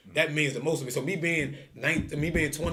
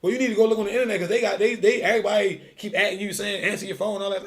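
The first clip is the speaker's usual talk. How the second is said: higher, louder scolding wife; voicing the wife; 8 semitones higher, 6 dB louder than usual. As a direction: nag him about the internet. Wife scolding him on phone.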